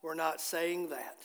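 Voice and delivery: low, hushed regretful thought; a mild groaning "Oh", then sinking quiet; inward regret. Retold or imitated. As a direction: mutter it as a rueful afterthought. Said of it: "we're thinking".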